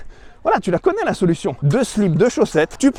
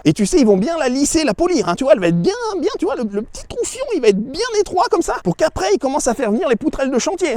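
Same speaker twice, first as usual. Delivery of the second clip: mock high taunt; 10 semitones higher than usual. Mocking crude taunt, high voice.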